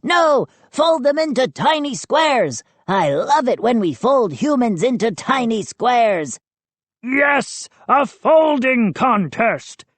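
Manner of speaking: high, excited gnome voice; high-pitched eager calling; crying-out gnome. A narrator's reading. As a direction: cry it out with glee. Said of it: "another gnome cried".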